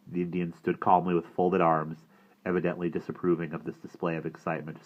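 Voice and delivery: usual speaking voice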